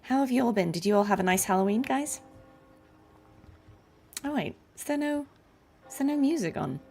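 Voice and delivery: normal talking voice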